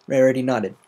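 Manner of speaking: plain reading voice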